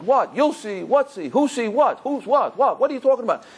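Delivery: baffled. Retold or imitated. Rabbi retells his confused reply.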